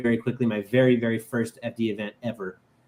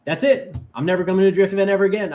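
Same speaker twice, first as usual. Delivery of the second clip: high, louder, very fast declaration; mock despairing outburst; 7 semitones higher, 6 dB louder, faster than usual. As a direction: blurt it out, hammed-up despair. Despairing retold vow.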